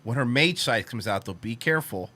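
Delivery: ordinary delivery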